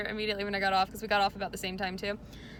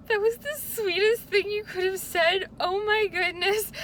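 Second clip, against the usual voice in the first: high, slow, gushing and touched; a high, drawn-out gush of delight; gushing sweetly; 8 semitones higher, 4 dB louder, slower than usual. Retold or imitated. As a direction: melt over a sweet compliment. Touched reply to kind child.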